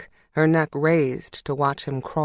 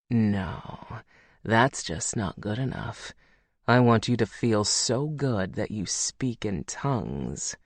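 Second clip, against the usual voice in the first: Logan's voice; 5 semitones lower, 4 dB quieter than usual. A narrator's reading. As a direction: lower male lover, low and seductive.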